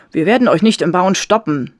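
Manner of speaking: put-on loud, firm young protester. A narrator's reading. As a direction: stand up to the workers, raising your voice. Young man confronts construction workers.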